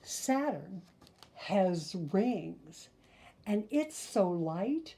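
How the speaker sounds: put-on lively, sing-song read-aloud for kids; storyteller voice for little listeners; reading to children. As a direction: read the rhymes like a bedtime picture book. Reads picture book to children.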